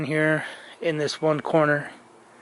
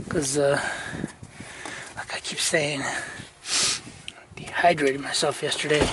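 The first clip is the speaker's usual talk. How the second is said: usual speaking voice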